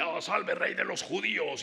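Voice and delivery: saludo burlón, más agudo y teatral; burla. Acted. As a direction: imita un homenaje fingido. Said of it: "como esa burla de". (mofa de soldado, voz alzada)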